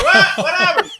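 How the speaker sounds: high-pitched, laughing mock outrage; mock disbelief. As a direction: squeal it in comic disbelief. Mock reaction to a brush-off.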